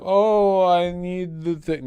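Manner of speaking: high, pleading mock whine; whiny beggar voice. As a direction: beg for money like a needy streamer. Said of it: "that thing where it's like".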